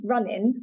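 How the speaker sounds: RUNNING said as runnin', ending on n. Shown as a demonstration. Explaining what used to be seen as standard, in ending. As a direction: drop the G in running. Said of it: "with an in ending".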